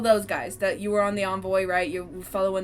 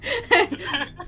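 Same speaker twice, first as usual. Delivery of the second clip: shrieking giggle; high squealing laugh; 9 semitones higher, 6 dB louder than usual. Real, not acted. Laugh tag alone.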